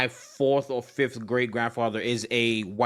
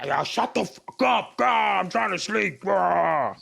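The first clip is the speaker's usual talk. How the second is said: groggy annoyed; 5 semitones higher, much slower than usual. Retold or imitated. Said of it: "I am vi".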